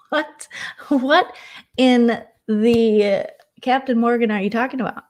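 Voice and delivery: high, loud startled what; disbelief. Retold or imitated. Shocked on hearing herself mentioned, high and loud. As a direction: recoil in shock at being named.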